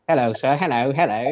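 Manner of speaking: prim upper-class English greeting; posh English accent. Retold or imitated. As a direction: put on a proper posh English voice. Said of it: "posh English voice".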